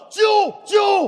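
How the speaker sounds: dramatic high call for help; cry for help. Acted. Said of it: "我大声求救，喊道".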